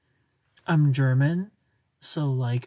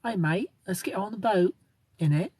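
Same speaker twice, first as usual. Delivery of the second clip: higher-pitched mock British; attempted British accent; 4 semitones higher than usual. Shown as a demonstration. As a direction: put on an imperfect British accent. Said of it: "I'll say it in a British accent".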